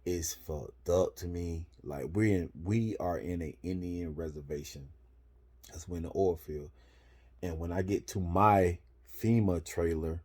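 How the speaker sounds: normal talking voice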